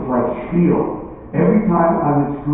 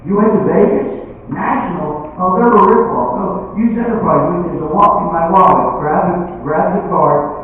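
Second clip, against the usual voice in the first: higher-pitched, casual mid-surgery chatter; chatty doctor; 7 semitones higher than usual. Acted. Doctor chatting to nurses about Vegas rentals, breezy and high. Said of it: "talking to the nurses about renting cars".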